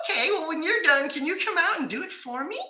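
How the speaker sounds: wife asking her husband for help, in a high, pleading voice; imitating wife. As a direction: whine sweetly for help with the bulb. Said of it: "She's changing light bulbs outside".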